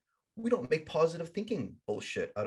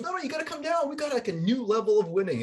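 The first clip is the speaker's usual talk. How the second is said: high, insistent friend; acted excitement, pitched well up; excited urging; 9 semitones higher, 3 dB louder, faster than usual. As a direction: coax a friend out eagerly. Friend urges Pip to come down.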